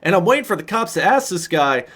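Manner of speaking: usual speaking voice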